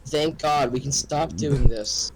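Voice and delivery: ordinary talk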